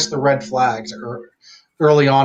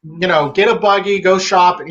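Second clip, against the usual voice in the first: Western PA accent; 5 semitones higher than usual. Shown as a demonstration. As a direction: lay on the Pittsburgh dialect, unhurried. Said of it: "that's what Western P A people say".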